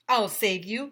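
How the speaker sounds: heroic shout. Acted. Mister Tony diving to rescue, heroic shout. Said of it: "shouted Mister Tony".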